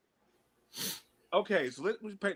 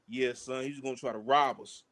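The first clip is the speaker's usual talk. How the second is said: New York cool; 5 semitones lower, 4 dB quieter than usual. Retold or imitated. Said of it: "in that New York sense of mind".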